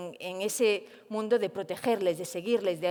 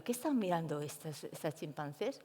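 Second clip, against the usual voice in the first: quiet inner thought; 5 semitones lower, 8 dB quieter than usual. Retold or imitated. Her own worried inner thought.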